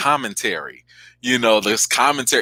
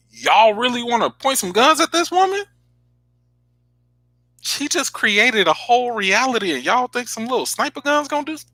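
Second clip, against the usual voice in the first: exasperated scolding; 13 semitones higher than usual. Retold or imitated. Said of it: "I was like".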